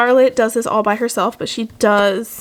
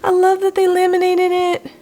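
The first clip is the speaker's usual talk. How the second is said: high, gushing delight; thrilled; 8 semitones higher than usual. Real, not acted. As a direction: gush over it, much higher. Delighted by the laminated card.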